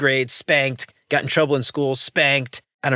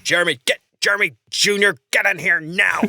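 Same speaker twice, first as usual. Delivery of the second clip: higher-pitched mock dad summons; 5 semitones higher than usual. Shown as a demonstration. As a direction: call the kid in like a fed-up dad. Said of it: "I use my dad voice".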